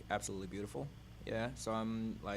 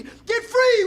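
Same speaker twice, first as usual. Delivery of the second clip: shouted; 21 dB louder than usual. Acted. Poet crying out the repeated line to the crowd, shouted. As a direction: Get free yelled much higher and louder.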